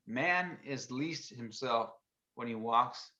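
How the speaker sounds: very slow, careful read. Real, not acted. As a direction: read it out word by word. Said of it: "I'm gonna read it to you".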